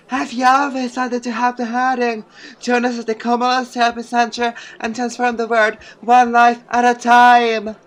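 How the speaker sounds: high, sweet helping-center pitch; charity ad voice. Acted. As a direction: invite them gently to help.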